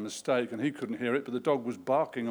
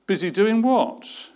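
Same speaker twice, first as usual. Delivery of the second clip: puzzled; 7 semitones higher, 8 dB louder than usual. A narrator's reading. CEO puzzled on phone.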